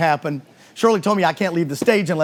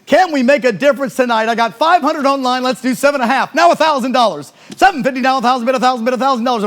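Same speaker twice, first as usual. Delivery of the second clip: loud, higher, rapid auctioneer bid calling; frantic trading floor shouting; 6 semitones higher, 5 dB louder than usual. Real, not acted. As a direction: rattle off bids like an auctioneer. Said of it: "auction bid calling".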